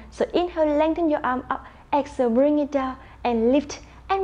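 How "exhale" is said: ordinary delivery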